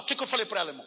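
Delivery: ordinary talk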